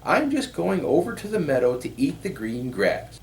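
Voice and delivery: small innocent goat explaining itself; little goat voice. A narrator's reading. Little goat explaining to the troll, high small voice.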